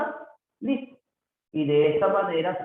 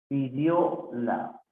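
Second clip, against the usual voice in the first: "Pidióla" slow, with "la" set apart; broken into syllables; much slower than usual. Shown as a demonstration. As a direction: separate the last syllable of "Pidióla". Teacher breaks word up. (separando las sílabas, despacio)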